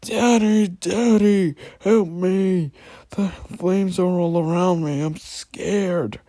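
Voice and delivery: put-on high trembling child's cry for help; mock scared little boy. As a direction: squeal it like a terrified little boy. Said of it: "put on a trembling voice to imitate the little boy and screamed".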